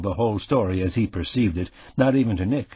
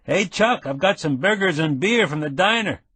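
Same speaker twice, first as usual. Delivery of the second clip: higher, cheerful call through the door; friendly call; 8 semitones higher than usual. Acted. Nick calls through the trailer door, friendly and high. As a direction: hail him like an old pal. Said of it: "called out in a friendly voice".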